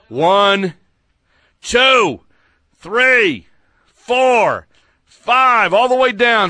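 loud drill count, pitch up; counting push-ups loudly. Pretend. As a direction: bark out each rep.

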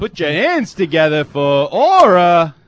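booming, drawn-out stage introduction; strip-club announcer. Pretend. Introducing a featured dancer at the Foxy Lady, as strip-club announcer. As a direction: announce a dancer with big, slow, loud showmanship.

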